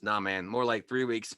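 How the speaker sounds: usual speaking voice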